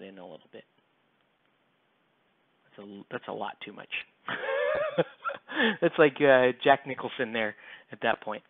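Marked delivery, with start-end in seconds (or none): 4.3-5.8 high-pitched burst of laughter; amused laugh. Real, not acted. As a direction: crack up at your own mess. Laughs at overdone paint job.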